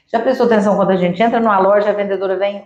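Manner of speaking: ordinary talk, nothing marked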